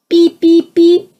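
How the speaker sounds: high, loud electronic beeps; imitating a beep. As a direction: beep like a shrill alert. Mystery beeping again.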